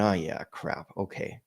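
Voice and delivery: low, soft giving-in; retold sheepish acceptance, dropped in volume; resigned inner reply. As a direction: mutter a reluctant concession to yourself. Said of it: "And I went".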